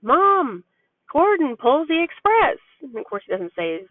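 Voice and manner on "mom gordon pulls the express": lightly voiced little boy, higher; a mom's light take on her toddler's excited line; imitating her son. Mom voicing her son's excited train talk, a touch higher. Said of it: "He's been running around going on about".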